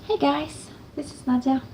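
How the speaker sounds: normal talking voice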